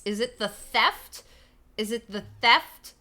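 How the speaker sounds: THEFT punched loud with a rising tone; slow question, hammering the word THEFT; accusing lift on THEFT; pointed stress on THEFT. Acted. Mockingly questions the book's creeps.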